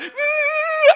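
very high, loud excited whoop; little girl's whoop. Retold or imitated. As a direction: squeal it like a thrilled kid. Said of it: "Same thing happened".

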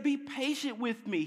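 mildly pleading, pitched high; begging. A narrator's reading. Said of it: "he begged".

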